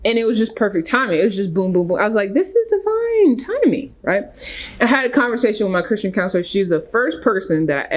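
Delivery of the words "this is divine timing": amazed. Retold or imitated. Retold inner thought of amazement.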